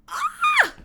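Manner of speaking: sudden high fangirl squeal; excited squeal. Real, not acted. Fan squealing at the details in the eyes. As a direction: let out a shrill burst of delight.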